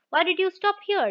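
a mildly read wife demanding to know why he stopped. Acted. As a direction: press him hard for an answer. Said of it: "demanded the wife".

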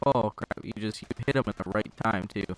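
usual speaking voice

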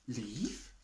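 soft, small stunned question; shocked Deer. Acted. Deer, stunned, softly asks about leaving. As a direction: barely get the word out. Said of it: "asked Deer".

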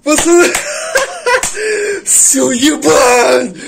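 loud cheering yell with a laugh; excited yelling. Real, not acted. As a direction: yell it out in triumph.

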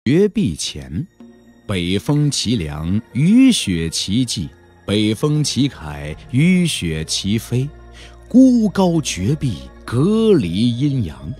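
slow, drawn-out recitation; poetic declamation. A narrator's reading. Narrator paints the desolate cliff for listeners, in slow poetic declamation. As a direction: intone it slowly, like solemn verse.

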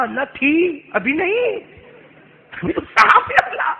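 high, loud, mock-panicked retelling; alarmed protest. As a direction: protest as if facing a snake. Moses protests it's a snake now.

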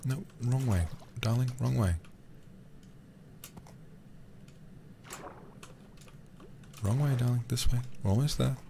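gently coaxing the horse. Real, not acted. Streamer guiding his horse Juanita, gentle coaxing. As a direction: sweet-talk her along the path.

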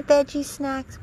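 ordinary talk, nothing marked

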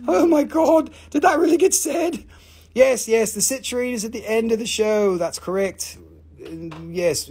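usual speaking voice

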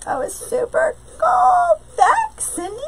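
squealed very high; excited squeal. Real, not acted. Crafter thrilled about her new sign, squealing high.

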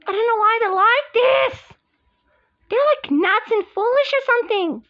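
very high, upset wail; pretend screaming. Upset at the rivals' behaviour, high pretend scream. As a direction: cry out far above normal pitch. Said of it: "Guys, don't scream anymore".

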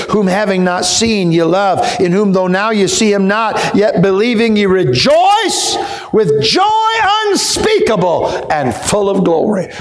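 rising to a high, slow shout; preaching scripture. Acted. Preacher quoting Peter to the church, building to a shout. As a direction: build into a fervent preached cry.

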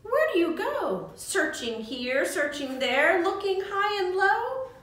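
lively, sing-song storybook reading, voices high for the animals; reading to children. Acted. Teacher reads The Golden Egg aloud to young friends, sing-song. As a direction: read it like a bedtime picture book.

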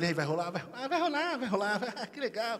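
soft put-on voice, playful; soft teasing wife. Wife softly teasing husband about tonight. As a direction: coo it softly like an eager wife.

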